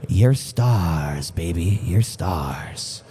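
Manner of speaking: low, drawn-out schmoozer voice; put-on deep showbiz voice; showbiz flattery. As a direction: drop way down low and croon the praise. Showbiz praise to drivers.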